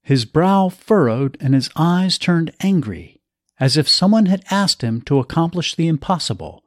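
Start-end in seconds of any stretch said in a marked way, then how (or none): none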